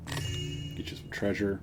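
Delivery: ordinary delivery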